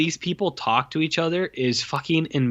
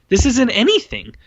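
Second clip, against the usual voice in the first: high, louder exasperation; exasperated; 10 semitones higher, 3 dB louder than usual. Retold or imitated. Viewer fed up with the movie, high exasperated outburst. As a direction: throw up your hands in disbelief. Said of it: "I was like".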